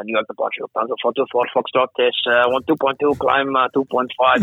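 put-on German-accented pilot radio call. Lufthansa pilot calling New York departure, in a German accent. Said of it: "this is my best German accent".

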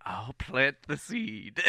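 slow, laughing hint of a horse voice; talking horse. Horse looking at Zippy, lightly voiced animal. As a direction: draw it out as a knowing horse. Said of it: "it's just like".

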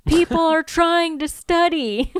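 high, drawn-out indignant complaint; irritated complaint. Retold or imitated. Dorm neighbor sticks his head out at noisy fighting, irritated.

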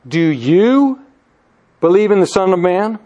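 "you" stretched with a rising pitch, pause after it; stress on you. Shown as a demonstration. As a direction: hit "you" long and rising before carrying on. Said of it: "that "you" is emphatic".

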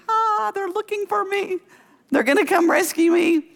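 high, sing-song hopeful cry; hopeful relief. Retold or imitated. Imagining rescuers at last.

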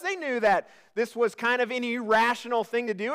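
ordinary talk

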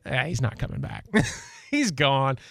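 imitated knowing friend, breaking into a laugh; amused dismissal. Friends who know his habit dismissing the excuse, amused.

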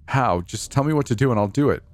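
high-pitched, urgent begging; desperate pleading. A narrator's reading. Narrator begging the man for a way out, desperate pleading. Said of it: "I pleaded with him desperately".

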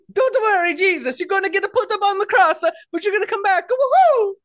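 high, loud cartoon Italian accent; chirpy Italian plumber, building to a big whoop; Mario. Hero cheering up Jesus on the cross, high Italian accent. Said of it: "It's Mario".

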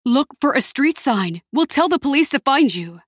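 steady her with urgency; urgent calling. Acted. Urgent phone instructions.

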